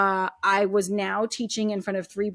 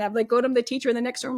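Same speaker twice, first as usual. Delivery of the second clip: ordinary talk, nothing marked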